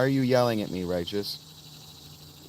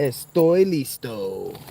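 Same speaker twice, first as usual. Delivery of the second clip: put-on Spanish accent; 3 dB louder, much slower than usual. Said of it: "How was my accent".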